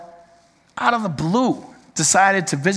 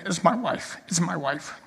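crying; 9 dB quieter than usual. Retold or imitated. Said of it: "Bella was crying like a baby".

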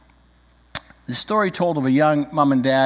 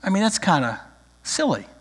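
usual speaking voice

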